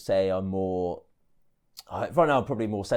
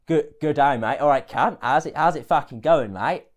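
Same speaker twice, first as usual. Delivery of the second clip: loud, higher-pitched attempt at Australian; Australian accent; 5 semitones higher, 7 dB louder than usual. Shown as a demonstration. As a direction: lay on a brash Aussie accent. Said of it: "how do you do Australian accent?".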